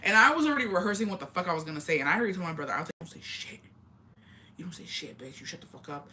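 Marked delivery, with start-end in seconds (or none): 3.0-6.1 low-volume whispered threat to a sibling; acted-out whisper, much softer, keeping someone quiet; hushed warning. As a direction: hiss a secret order under your breath. Secretly warning brother before facing parents.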